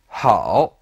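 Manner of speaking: "hao" low and dipping, then rising; third tone. Shown as a demonstration. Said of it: "are both third tone".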